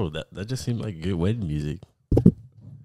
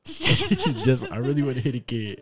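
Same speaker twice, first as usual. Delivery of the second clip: laughing while quoting him back; mock him through laughter; laughing mockery; 6 semitones higher, 3 dB louder, slower than usual. Mocking friend, laughing.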